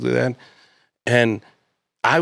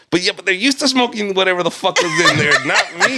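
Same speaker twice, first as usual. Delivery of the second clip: high-pitched retold self-argument; acted-out inner protest, voice climbing; arguing with himself; 8 semitones higher, 3 dB louder than usual. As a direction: argue it out with yourself, pitched up. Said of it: "this is me arguing to myself".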